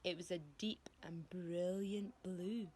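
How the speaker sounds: ordinary delivery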